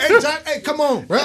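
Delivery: high-pitched, laughing protest; exasperated protest. Retold or imitated. Protesting to Josh at home, exasperated and high.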